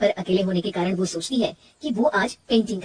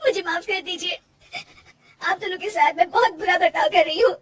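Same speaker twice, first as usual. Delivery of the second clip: higher, crying plea for forgiveness; tearful apology; 8 semitones higher than usual. Acted. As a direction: apologise to husband and mother-in-law in tears. (रोते हुए माफ़ी माँगना)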